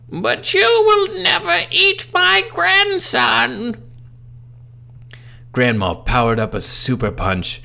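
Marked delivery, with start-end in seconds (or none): 0.1-3.8 very high, loud, slow shriek; furious granny. Acted. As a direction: scream it like a vengeful ghost. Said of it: "my grandmother said".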